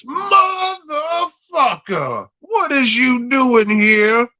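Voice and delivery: camp it up, nothing like the real actor; goofy put-on soldier. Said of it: "But he says it more like Lance Henriksen".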